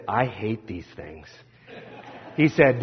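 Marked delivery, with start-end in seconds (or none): 0.1-1.3 low grim admission. Retold or imitated. Friend admits fear of ride.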